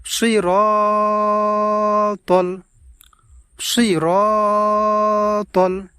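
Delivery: সিরাতাল with the র vowel drawn long; stretched র. Shown as a demonstration. Teacher showing viewers the long র in সিরাতাল. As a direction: hold the র in সিরাতাল. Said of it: "আওয়াজটাকে একটু লম্বা করে পড়ব এইভাবে".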